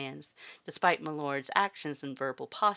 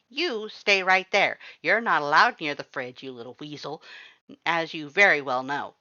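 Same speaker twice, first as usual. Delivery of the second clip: stern Papyrus; 2 semitones higher, 9 dB louder than usual. A narrator's reading. Papyrus orders Axe away from the fridge, loud stern scold. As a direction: bark at the weasel brother, big and bossy.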